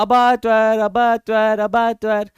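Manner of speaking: a piglet's mock cry for help, loudest at the start then fading; half-playful yell, calling out for papa; child yelling. Teacher acting the piglet crying out as papa is cut, mock yell. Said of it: "बच्चा चिल्ला रहा है".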